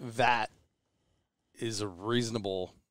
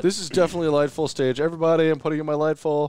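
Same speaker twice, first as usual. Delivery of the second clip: loud, raised-pitch playful boast; mock showing off; 6 semitones higher, 8 dB louder, faster than usual. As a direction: announce it to everybody with mock importance. Plays a show-off shooter announcing gear.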